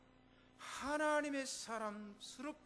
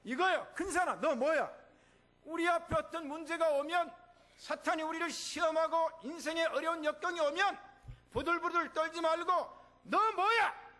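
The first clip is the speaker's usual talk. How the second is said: loud, high defiant preaching; shouting down Satan; 9 semitones higher, 7 dB louder than usual. Acted. Pastor leading the church against Satan, loud and defiant. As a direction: rebuke the mountain with full force.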